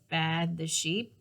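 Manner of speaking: usual speaking voice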